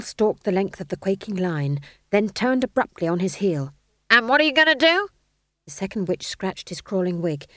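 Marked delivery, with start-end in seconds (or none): 4.1-5.1 high, loud challenge; drill sergeant demand. A narrator's reading. Stage manager quizzing the witch line, loud and demanding. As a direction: fire the question at them.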